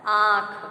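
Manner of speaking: आँख loud, with a nasalised aa; correct pronunciation. Shown as a demonstration. Teacher giving the correct form, loud nasalised vowel. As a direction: sound आँख through nose and throat, loudly. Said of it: "इसलिए शुद्ध रूप है हमारा".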